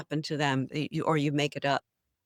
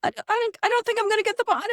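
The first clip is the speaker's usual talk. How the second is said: very high, squeaky mock crying; hammed-up whining, breaking into laughter; mock wail; 4 dB louder, faster than usual. Actor phoning father about losing the part, high mock wail.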